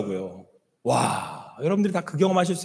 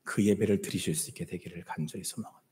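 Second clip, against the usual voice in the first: earnest whisper; 11 semitones lower, 9 dB quieter, faster than usual. Real, not acted. Earnest closing prayer wish.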